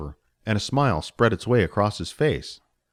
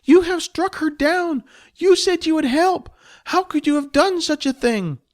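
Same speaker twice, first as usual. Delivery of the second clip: high squeaky goblin voice, accusing and anguished; a small goblin grieving her dead master, much higher; distraught goblin; 4 dB louder than usual. Acted. Spleef the goblin wails at Jess over the dead master.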